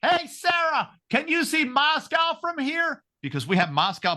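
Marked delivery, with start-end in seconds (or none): 0.0-3.0 high heckling call across the airport; mock yell. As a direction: shout up to her, teasing. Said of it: "and I screamed".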